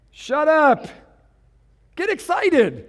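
high, loud mocking crowd; fickle crowd. Pastor mocking the crowd's fickle response, loud. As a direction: bark it then flip to cheer. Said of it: "That was their response".

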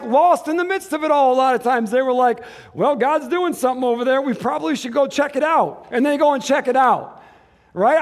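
loud, high, impassioned sermon climax; full-force preaching. Real, not acted. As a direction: drive it home to the whole church.

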